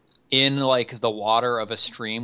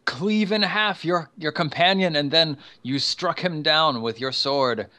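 high, astonished witness voice; Nud; 5 semitones higher than usual. Acted. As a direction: report it high, in amazed disbelief. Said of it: "He says".

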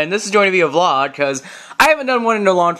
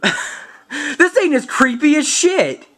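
laughing disbelief; 7 semitones higher, slower than usual. Real, not acted. Laughing at mask.

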